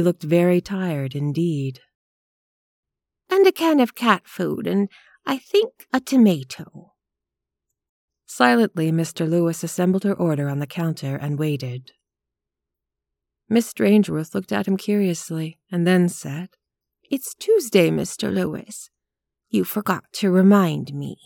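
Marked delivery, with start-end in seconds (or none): 3.3-6.9 lightly voiced old lady, pitched higher; a genteel spinster placing her order; prim old lady. Elderly lady to the grocer, lightly voiced genteel tone. 17.1-21.2 a hint of a fussy spinster reminding him; prim old lady. Elderly lady to the grocer, lightly voiced mild reproach.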